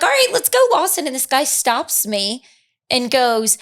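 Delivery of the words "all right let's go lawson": eager call. Retold or imitated. Retelling her eager entrance.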